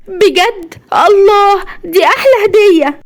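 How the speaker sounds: very high, thrilled voice; delighted surprise. A narrator's reading. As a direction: squeal with joy at a wonderful gift. Sister gets a surprise gift. (بفرحة ودهشة كبيرة)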